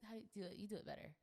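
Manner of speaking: normal talking voice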